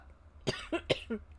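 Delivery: choking cough on water; sputtering cough after swallowing wrong. Real, not acted. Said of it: "water went down the wrong pipe".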